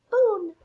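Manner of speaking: loud high pop sound; high loud popping noise; balloon pop. Acted.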